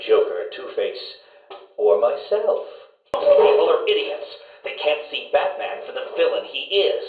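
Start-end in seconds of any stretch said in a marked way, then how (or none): 3.1-4.3 sudden high, louder scornful snap; a burst of contempt at the fools; contemptuous outburst. Acted. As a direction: spit it out in disgust. Ranting at the doctor.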